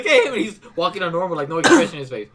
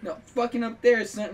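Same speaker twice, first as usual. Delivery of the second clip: normal talking voice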